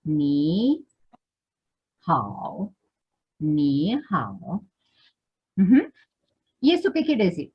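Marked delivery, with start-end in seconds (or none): 0.0-2.7 "Ni" drawn out rising, pause, "hao" low dipping; questioning first syllable. Shown as a demonstration. Teacher breaks the greeting apart for students, questioning "Ni". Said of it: "como una pregunta". 3.4-4.6 "Ni hao" with a low start rising on "Ni"; questioning first syllable. Shown as a demonstration. Teacher repeats the correct tune, questioning rise on "Ni". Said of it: "como una pregunta".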